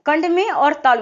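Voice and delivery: usual speaking voice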